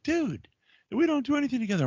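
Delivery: high mock complaint; mock pleading. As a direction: whine it to the wife. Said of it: "I'm like".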